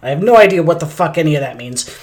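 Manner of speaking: ordinary delivery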